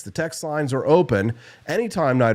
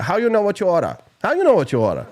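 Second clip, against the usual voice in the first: higher put-on Chinese accent, laughing into it; mock Chinese accent; 7 semitones higher, faster than usual. Family inside joke retold, high mock Chinese accent. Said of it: "enough with the racist jokes".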